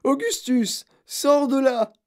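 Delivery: aigu et fort; appel crié. Acted. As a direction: crier à son fils. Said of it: "hurla madame Gloop". (la mère crie à son fils coincé, aigu)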